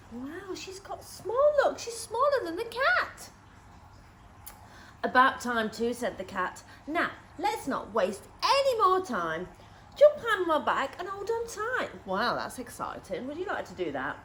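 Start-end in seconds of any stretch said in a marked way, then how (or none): 0.1-3.3 amazed. Acted. Reader amazing little listeners at the shrinking, higher. 5.0-12.0 louder, brisk cat voice; bossy cat. Acted. Cat telling Missus Pepperpot to jump on, louder.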